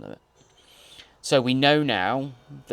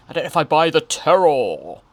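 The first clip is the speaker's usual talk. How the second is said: loud, higher, theatrical swell on "terror"; hammed-up proclamation; 4 semitones higher, 11 dB louder than usual. Host doubting the child's acting, hammed-up loud delivery. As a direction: play up "terror" with mock drama. Hams up the repeated line.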